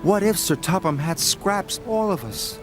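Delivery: worried sadness. Acted. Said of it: "Thomas said sadly".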